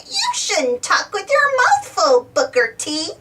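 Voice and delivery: giggling. A narrator's reading. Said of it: "Baby Bop giggles".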